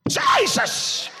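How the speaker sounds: high, loud mock scream of Jesus; hammed-up panicked call to Jesus; panicked cry. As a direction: cry out like a frightened passenger. Ladies crying out in panic.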